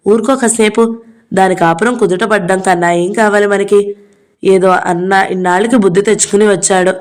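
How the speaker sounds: ordinary delivery, nothing marked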